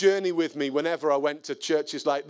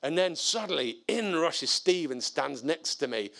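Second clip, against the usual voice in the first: usual speaking voice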